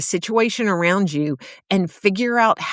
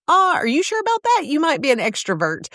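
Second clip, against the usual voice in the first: teasing disbelief; 8 semitones higher, faster than usual. Pretend. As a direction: jump way up high and quick, playfully doubtful. Imagined listener doubting son is introvert.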